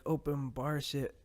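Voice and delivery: usual speaking voice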